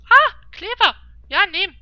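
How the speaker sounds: loud, thrilled discovery; excited. Real, not acted. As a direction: cheer at finding it. Finds the glue at last.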